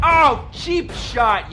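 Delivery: put-on a drawn-out hurt yell at a kid's insult; stung shout. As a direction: shout it like you've been hit.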